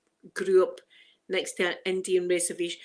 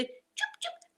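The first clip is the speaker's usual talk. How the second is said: short, higher "choop" bird calls; bird chirps; 10 dB quieter than usual. Shown as a demonstration. Telling Angela how cougars sound, chirping. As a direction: imitate wee birds chirping. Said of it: "the li-, wee birds".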